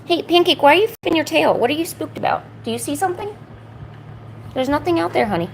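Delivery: high soothing pet voice; calm a nervous pet; talking to a dog. Real, not acted.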